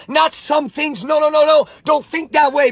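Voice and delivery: ordinary talk, nothing marked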